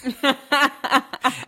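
high bursting laugh; cracking up at the voice; burst of laughter. Real, not acted. Host laughs at chipmunk voice.

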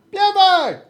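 high, yelled call across the yard; hollering a name outside. Acted. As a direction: shout the name to someone far off. Calling out again.

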